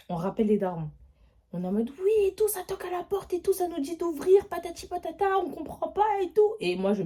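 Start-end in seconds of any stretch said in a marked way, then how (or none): 2.0-6.5 higher, breathless panicked report; retold panic on the phone; panicked call. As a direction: blurt it all out to the parents.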